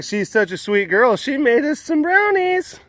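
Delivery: high, sing-song praise; sweet high voice. Acted. Cooing over the sweet girl who baked, high and sing-song. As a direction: coo it sweetly like a proud aunt.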